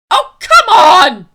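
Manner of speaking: very loud, high, drawn-out outburst; fed-up bellow, stretched slow; exasperated shout. Real, not acted. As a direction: yell it in total disbelief at the plot. Exasperated outburst at the movie's plot.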